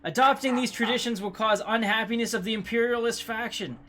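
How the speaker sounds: high, loud, disbelieving read-out; incredulous reading. Real, not acted. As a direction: read it aghast at the news. Reading bad trait in disbelief.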